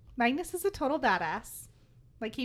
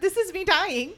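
loud, higher, overcome with glee; giddy fangirling; 7 semitones higher, 6 dB louder than usual. Retold or imitated. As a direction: gush like you're melting. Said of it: "and just said".

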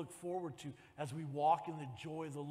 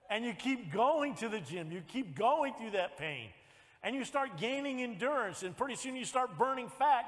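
rising, louder, higher preaching build; preaching at full force; 9 semitones higher, 10 dB louder than usual. Acted. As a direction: drive the congregation up to a peak, higher and louder.

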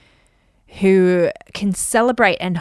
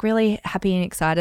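ordinary delivery

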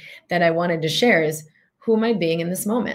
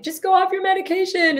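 much higher, brushing it off lightly; airy mock voice, pitched way up; mock careless advice; 12 semitones higher than usual. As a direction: squeak out a flippant bad tip. Mock advice she won't give.

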